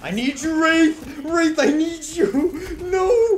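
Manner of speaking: high, loud hammed-up begging; overacted desperate cry; dramatic plea. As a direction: beg a comrade like bad melodrama. Mock voice acting plea to teammate.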